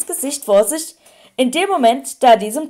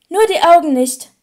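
put-on higher, louder wheezy old-wizard voice; 5 semitones higher, 6 dB louder than usual. A narrator's reading. Silver-haired wizard correcting the others about Harry's looks, wheezy. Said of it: "mit pfeifender Stimme".

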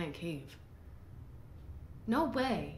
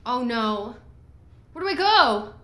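high, loud frantic pleading; panicked cry; 7 semitones higher, 10 dB louder than usual. Real, not acted. Gamer freaking out and not knowing where to go, panicked. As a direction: yell for help, totally freaked out. Panicking in scary game scene.

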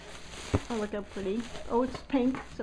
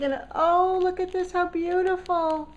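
high, louder delight; excited; 9 semitones higher, 5 dB louder than usual. Real, not acted. Finding Terry's handmade tag, high excited delight. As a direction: swoon over the gift.

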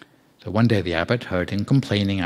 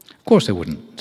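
mild indignation; 8 dB louder than usual. A narrator's reading. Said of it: "said the boy indignantly".

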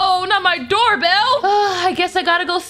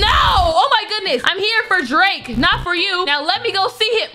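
normal talking voice

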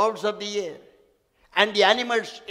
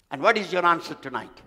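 usual speaking voice

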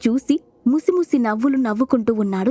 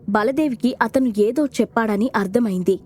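ordinary delivery

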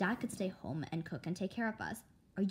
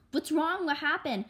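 higher, louder startled questions; alarmed; 8 semitones higher, 6 dB louder than usual. Acted. Mother startled by her daughter's scream, alarmed. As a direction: ask in sudden alarm.

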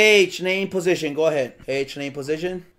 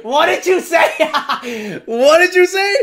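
high, loud question through laughter; real laughing outburst; cracking up; 9 semitones higher, 4 dB louder than usual. Real, not acted. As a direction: ask in disbelief while laughing hard. Cracking up at misheard word.

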